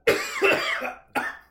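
loud choking cough after a shot; harsh coughing fit. Real, not acted. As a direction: cough hard, throat burning. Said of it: "That's not a car blowing dust".

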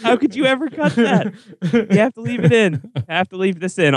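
high, laughing protest. Real, not acted. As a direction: laugh while insisting. Laughing, insisting clip stays.

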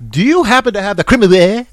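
loud, high, grandly affected enquiry; put-on intellectual. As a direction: ask with exaggerated highbrow politeness. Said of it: "I can sound very intellectual".

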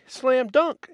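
high, loud crowing boast; mocking quote. Quoting a rival researcher's slam dunk claim, loud mocking crow. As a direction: crow it like a sure win. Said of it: "as Walt Brown said".

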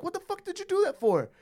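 high-pitched exasperated demand; mock parental scolding. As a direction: act out confronting a kid who slapped you. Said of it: "look at him and be like".

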